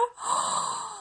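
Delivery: soft high gasp; amazed gasp. Acted. Old lady amazed at her big house, gasping. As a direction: gasp in wonder.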